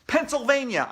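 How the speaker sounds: loud, high blurted answer; shouted answer. A narrator's reading. Boy shouting a wrong answer, loud and high. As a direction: yell it out eagerly. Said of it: "I shouted".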